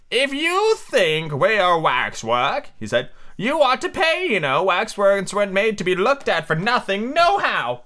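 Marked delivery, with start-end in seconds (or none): 0.1-2.7 slow, drawn-out storybook twin; higher, deliberate character voice; Tweedledum. Acted. Voice of the twin marked Dum. 3.4-7.8 high, indignant storybook twin; high-voiced, put-out little man; indignant Tweedledum.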